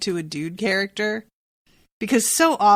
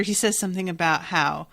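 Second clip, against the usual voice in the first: ordinary delivery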